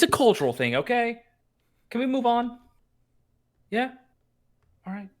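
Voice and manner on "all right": ordinary delivery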